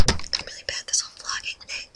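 fast, hushed whisper; whispered aside. Real, not acted. Vlogger admits being bad at vlogging, hurried whisper. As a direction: whisper it quickly to yourself.

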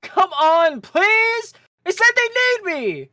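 high, loud begging whine; pleading teen boy. Acted. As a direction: beg her, voice up high. Leo begging sister in scene.

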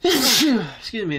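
sudden cough mid-sentence; coughing fit interrupting. Real, not acted. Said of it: "Excuse my sneezing".

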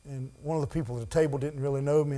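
ordinary talk

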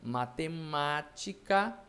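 MATEMÁTICA drawn out slowly, syllable by syllable; stretched syllables. Shown as a demonstration. Teacher giving an example, matemática slowly drawn out. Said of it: "por exemplo".

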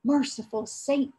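mother's dismayed exclamation, lightly voiced; a touch of pious alarm; dismayed cry. A narrator's reading. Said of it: "cried his mother".

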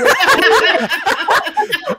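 big burst of laughter; loud laugh. Real, not acted. Co-host joke about sponsorship.

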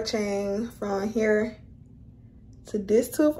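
lip held down by finger; talking with finger in mouth. Real, not acted. Seen 0: Finger pulls lower lip down to show braces while speaking.

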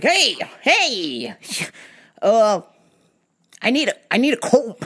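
high, quick put-on voice of the bald guy; bald customer voice. Retold customer voiced in story.

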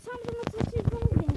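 loud, slow, fed up; exasperated shout. Real, not acted. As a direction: lose patience out loud. Sabrı taşıyor, bağırıyor. (sabrı taşmış, bağırarak)

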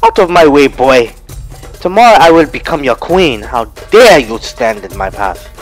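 loud, high imperious scolding; haughty angry command. Acted. Manyara to the boy in her way, loud and haughty. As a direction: drive the boy from the path.

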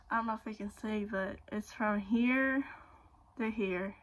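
talking with lips stretched open; fingers pulling lips apart. Real, not acted. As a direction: mouth held open by fingers. Seen 0: Finger pulls lip corner, then both fingers stretch lips showing braces.

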